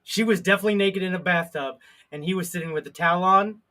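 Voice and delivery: ordinary delivery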